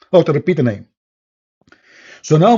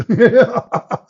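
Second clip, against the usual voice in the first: short laugh; 10 semitones higher, 4 dB louder than usual. Real, not acted. Laughs at his own story.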